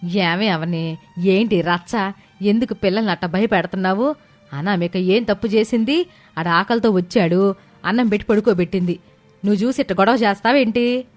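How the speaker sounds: mildly angry elder woman; scolding mother-in-law. A narrator's reading. Mother-in-law scolds Avani for frightening the children, mildly angry. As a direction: scold with restrained anger. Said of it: "శారదమ్మకు చాలా కోపమొచ్చింది".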